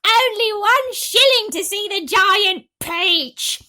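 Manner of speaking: high, loud, slow barker shout; yelling. Acted. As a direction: drag out the pitch to the crowd. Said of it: "Aunt Spiker yelled".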